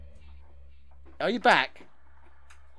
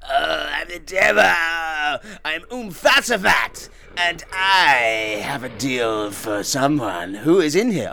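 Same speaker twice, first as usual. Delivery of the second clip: slow, hammed-up demon proclamation; drawn-out comic devil voice; 4 semitones lower, slower than usual. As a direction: boom it out as a theatrical Satan, dragging the words. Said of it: "Do your voices".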